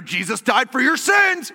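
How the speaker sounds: over-the-top evangelist call; mock street preaching. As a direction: proclaim it slowly, hammed-up. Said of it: "I'm not like".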